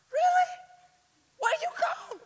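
high-pitched indignant squawk; incredulous exasperation. Retold or imitated. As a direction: demand it in shrieky disbelief. Exasperated at companions who didn't care.

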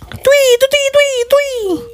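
high-pitched twee sounds; imitated tweeting noise. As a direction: squeal out a run of high tweets.

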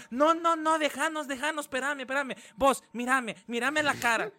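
high, loud shrill-style bossy friend; hammed-up protective friend shooing the guy; mock bossy friend. Host imitating the friend pushing the guy away, high and loud. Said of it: "Y está la amiga".

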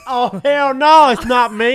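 loud drawn-out hell no; hammed-up, stretching the words; mock hype guy voice. Mock strip club role play.

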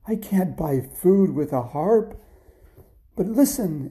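high, loud, slow protest; exasperated mother. Acted. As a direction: stretch out a despairing scolding. Mother exasperated at Jack's harp.

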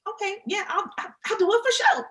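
high, bright yes from a guest; eager guest voice. Retold or imitated. Guest she pitched agrees eagerly, lightly voiced high yes. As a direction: play the happy guest agreeing. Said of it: "they kind of are like".

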